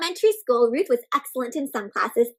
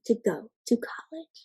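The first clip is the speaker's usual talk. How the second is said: whispered; 7 dB quieter than usual. A narrator's reading. Storyteller drops voice.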